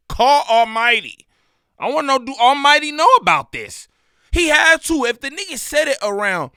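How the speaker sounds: high and loud, worked up; outraged host demanding answers; loud outraged call. Real, not acted. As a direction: demand it loudly, riled up.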